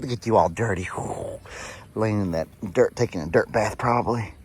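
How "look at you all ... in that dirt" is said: usual speaking voice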